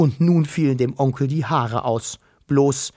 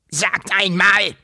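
slow, grumpy uncle's grunt; 6 semitones higher, slower than usual. A narrator's reading. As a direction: grunt it out, raised and drawn-out. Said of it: "grunzte er".

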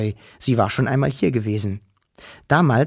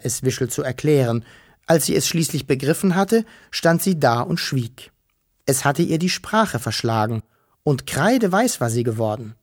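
ordinary delivery

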